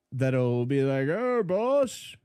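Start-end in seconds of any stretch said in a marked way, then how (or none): 1.1-2.2 slow-witted burly henchman calling his boss; dumb henchman. Retold or imitated. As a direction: dim heavy lug voice. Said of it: "those really dumb henchmen".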